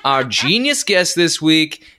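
slow, drawn-out showman build-up; grand announcer intro. Acted. Host hams up the slow segue to introduce the guest. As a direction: stretch it like a mock-grand emcee.

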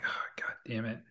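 very soft, breath-only curse; quietly muttered, retold exasperation; muttered dismay. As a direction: retell a defeated curse under the breath. Retelling his dismayed reaction.

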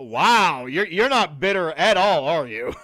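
loud, mock-amazed ribbing; teasing sarcasm. Real, not acted. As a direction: sarcastically tease a friend, loudly and with exaggerated tune. Teasing friend about an obvious grudge.

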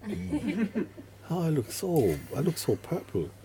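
a hint of a silly, entranced victim; mock dazed victim. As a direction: play the drained victim, charmed and foolish. Victim voiced admiring purple face.

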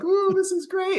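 very high, light ironic voice; mock delight. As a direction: pretend you're loving the pain. Kid's ironic inner thought.

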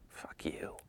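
very soft, whispered dismissal; whispered retort. Retold or imitated. Supermodel to photographer after his vague promise, whispered retort. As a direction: whisper the retort under your breath.

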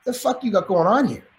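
imitated raspy stranger at the door. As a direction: lean in with a light rasp. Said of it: "in a raspy voice".

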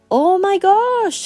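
high, slow mock amazement; sarcastic. Old classmate teasing friends on the sidewalk, sarcastic slow exclamation. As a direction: stretch it out in teasing disbelief. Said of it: "he said sarcastically".

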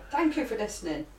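ordinary talk, nothing marked

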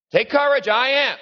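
high, ringing proclamation of Jesus; proclaiming. Acted. Jesus calling out to frightened disciples from the water, high and ringing.

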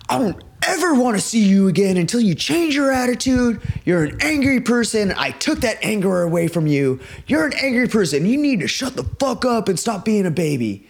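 high-pitched mock tirade; imitated scolding. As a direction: act out a friend's forceful telling-off. Acts friend scolding crying musician.

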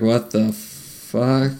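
very slow, soft mock astonishment; pretend stunned disbelief. Imagining seeing it in the mall, stunned slow disbelief. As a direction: draw it right out under the breath. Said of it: "I'd just kinda be like".

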